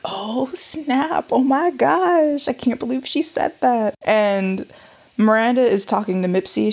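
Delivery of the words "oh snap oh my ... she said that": shocked gasp. Retold or imitated. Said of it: "everyone was just like".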